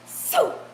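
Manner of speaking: sudden high, loud zip of sound; quick burst of a ride launching; imitated take-off whoosh. Showing viewers how the coaster takes off, sudden high whoosh. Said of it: "then you take off like this".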